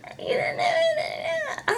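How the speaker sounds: high, choked sobbing mumble that breaks into a wail; hammed-up crying fit; imitating crying. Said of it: "what are you crying for?".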